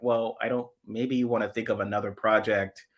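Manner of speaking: ordinary talk, nothing marked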